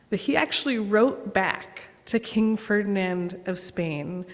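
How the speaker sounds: ordinary delivery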